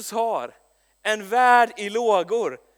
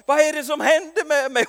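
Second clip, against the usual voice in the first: tearful colleague; 7 semitones higher, 6 dB louder than usual. Retold or imitated. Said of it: "bryter min kollega ut i tårar".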